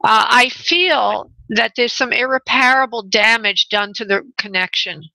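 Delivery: slow, careful phrasing; deliberate slow repeat; slowly for a bad line. Shown as a demonstration. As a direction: spell it out slowly so it gets through the noise. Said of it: "I'll just say it, uh, again slowly".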